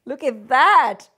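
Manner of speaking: usual speaking voice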